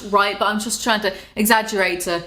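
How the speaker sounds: ordinary delivery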